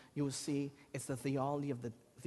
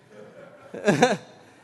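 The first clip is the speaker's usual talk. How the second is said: a loud burst of real laughter; big laugh; 5 semitones higher, 12 dB louder than usual. Real, not acted. Preacher laughing at his rejection, loud burst. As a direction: laugh out loud at your own story.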